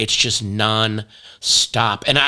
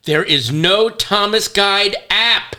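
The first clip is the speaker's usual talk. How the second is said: slow, higher and louder, each word drawn out; deadpan announcement; 9 semitones higher, 4 dB louder, much slower than usual. Real, not acted. Reporting a phone search to her cohost, slow loud announcement. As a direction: announce the verdict slowly and loudly.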